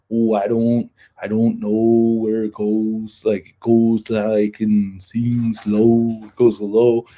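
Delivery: slow Midwest accent with long round o's. Shown as a demonstration. Showing the Midwest down tone, slow mock Midwest accent. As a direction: drag the o's downward like a Midwesterner. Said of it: "they have a little bit of a down tone".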